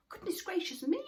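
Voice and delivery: high astonished gasp of words; surprised. Acted. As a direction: sound startled and amazed. Moomin Troll surprised exclamation.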